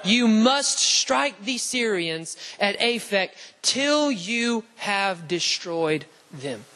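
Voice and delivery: slow, weighty, word-by-word delivery; emphatic scripture reading. Real, not acted. Preacher reading God's command to the congregation, slow and emphatic.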